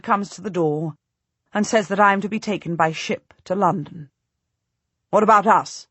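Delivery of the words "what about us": mild demand. A narrator's reading. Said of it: "my husband demands".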